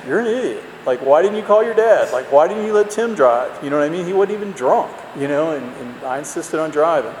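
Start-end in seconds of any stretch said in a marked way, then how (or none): 0.1-3.5 mock berating himself, higher; self-scolding. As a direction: scold yourself the morning after. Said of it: "I just think".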